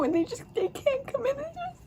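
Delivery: hammed-up crumbling sob; voice breaking. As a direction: pitch cracking way up as it fades to nothing. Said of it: "I get choked up".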